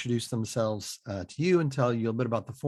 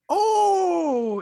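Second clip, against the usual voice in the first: surprised; 5 dB louder than usual. Real, not acted. Host hears pregnancy news.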